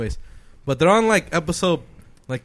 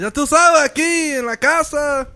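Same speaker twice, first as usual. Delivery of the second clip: Mexican dad; 11 semitones higher, slower than usual. Acted. Son voicing his dad as Mexican, high Mexican accent. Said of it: "made my dad sound Mexican".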